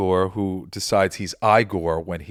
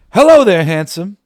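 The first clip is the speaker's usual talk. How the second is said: much higher and much louder sing-song greeting; a man sweet-talking the monster, much higher and louder; coaxing quote; 10 semitones higher, 14 dB louder than usual. Retold or imitated. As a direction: gush it like a desperate flatterer. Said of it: "my father would quote it all the time".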